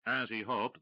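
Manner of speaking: usual speaking voice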